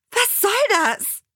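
high, slow indignant demand; exasperated. A narrator's reading. Alexa losing patience with Achim, high exasperated demand. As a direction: stretch it out in high outrage.

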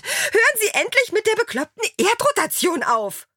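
high-pitched furious yelling; exasperated outburst. A narrator's reading. Alexa cutting off Achim's lecture, high exasperated outburst. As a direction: cut in at the top of your range.

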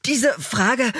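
high, hesitant start; flustered stammer. A narrator's reading. Achim answering the angry hostess, mildly flustered. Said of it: "haspelte Achim".

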